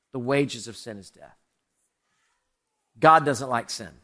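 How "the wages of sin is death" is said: "The wages of sin is death" dropped lower and softer; hushed, solemn echo of the verse; quiet repeat. Shown as a demonstration. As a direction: let "The wages of sin is death" sink to a hush.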